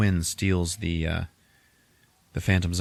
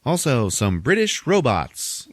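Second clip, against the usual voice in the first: higher, slow, drawn-out introduction; hammed-up announcer; 5 semitones higher than usual. Joker introducing more villains, in a high drawn-out announcer's call. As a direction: announce them like one more act on the bill. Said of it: "introduces everybody".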